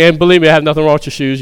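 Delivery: ordinary delivery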